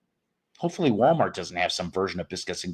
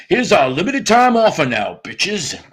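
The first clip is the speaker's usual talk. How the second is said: Foghorn Leghorn voice; 7 semitones higher, 5 dB louder than usual. Retold or imitated. Streamer parodies a KFC ad as Foghorn Leghorn, loud and high. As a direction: ham up a cartoon rooster pitching chicken. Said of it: "should always sound like Foghorn Leghorn".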